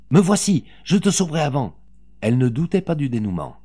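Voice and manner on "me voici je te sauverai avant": high, loud answering call; urgent promise. A narrator's reading. Mother rushing to save her son, urgent call. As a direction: call back that rescue is coming.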